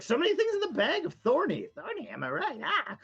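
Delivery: very high, fast squeaky creature chatter; gleeful tiny creature digging through a bag; excited little goblin. Acted.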